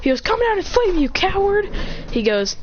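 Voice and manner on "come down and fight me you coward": high-pitched mock challenge; taunting challenge. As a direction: call up to him, high and goading. Acted challenge to flying opponent.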